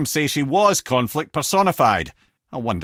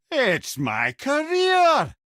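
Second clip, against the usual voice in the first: mock outrage; 13 semitones higher, much slower than usual. Mock indignant outburst.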